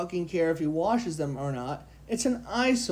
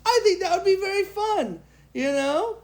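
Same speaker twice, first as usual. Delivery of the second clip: silly high voice; 10 semitones higher than usual. Pretend. Playful put-on voice.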